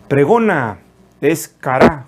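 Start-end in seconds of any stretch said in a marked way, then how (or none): 1.5-2.1 "cara" stressed on the last A; stress on final A. Shown as a demonstration. Preacher teaching a Hebrew word, "cara" stressed on final A. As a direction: say "cara" with the accent on the final syllable. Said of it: "con acento en la A".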